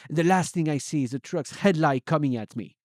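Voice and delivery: plain reading voice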